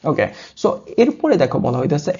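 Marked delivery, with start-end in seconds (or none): none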